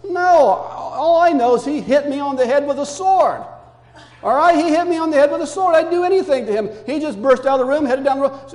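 put-on high, loud kid self-defence; child protesting innocence. Six-year-old explaining the sword incident to grandparent, indignant protest. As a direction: plead your case like an accused child.

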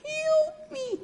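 very high, tiny squeal for help; tiny trapped fly. Acted. Fly stuck in spider web, tiny high plea. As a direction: plead in a minuscule high-pitched fly voice. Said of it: "he's sitting there in that spider web going".

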